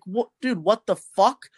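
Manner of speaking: high, incredulous what the fuck; retold outraged disbelief. Retelling being cheated at roulette, outraged disbelief. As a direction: pitch jumps up in disbelief. Angry retold outburst at casino.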